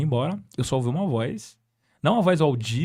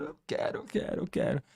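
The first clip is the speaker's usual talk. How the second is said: soft, breathy tearful imitation of the man crying; half-whispered through retold tears; tearful yes; 2 semitones higher, 7 dB quieter than usual. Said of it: "Ele chorando já".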